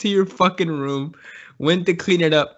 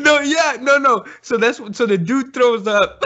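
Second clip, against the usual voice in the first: laughing; 6 semitones higher than usual. Real, not acted. Laughing while speaking.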